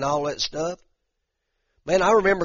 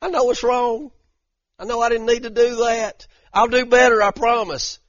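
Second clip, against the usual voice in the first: pleading with God; 6 semitones higher than usual. Retold or imitated. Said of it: "And I'd be like".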